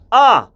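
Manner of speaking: short, crisp "아"; "아" punched out distinctly; pronunciation exercise. Shown as a demonstration. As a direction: say "아" as a clearly articulated drill. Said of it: "이 발음 연습".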